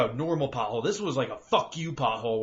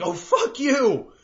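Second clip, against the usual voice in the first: mock angry yell; 11 semitones higher, 6 dB louder, much slower than usual. Cursing at traffic lights.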